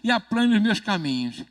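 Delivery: mais alto e devagar, proclamando; proclamação de fé. Shown as a demonstration. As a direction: declare cada palavra com força. (pastor puxando a oração, alto e lento)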